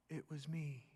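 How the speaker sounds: soft, low admission; quiet confession. Acted. The woman confesses to Jesus, softly. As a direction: barely admit it.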